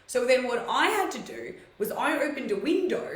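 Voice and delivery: usual speaking voice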